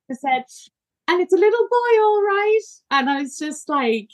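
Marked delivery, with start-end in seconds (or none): 1.1-2.6 high, breathless and amazed; awed announcement. Retold or imitated. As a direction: marvel at the little boy. Announcing her baby's arrival.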